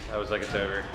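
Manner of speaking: usual speaking voice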